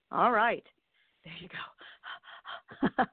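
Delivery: breathless after a long read; out of breath. Real, not acted. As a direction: gasp it out, out of breath. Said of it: "I'm out of breath now".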